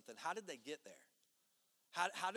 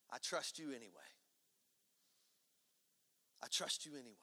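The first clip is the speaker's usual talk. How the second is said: softer, lower, gentle trust; softly giving in after crying out; quiet surrender; 6 semitones lower, 7 dB quieter, much faster than usual. Retold or imitated. Surrender after loud prayer.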